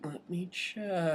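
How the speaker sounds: soft, slowly stretched acted reply; hushed stalling. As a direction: trail it out quietly, buying time. Acting out his own unsure reply.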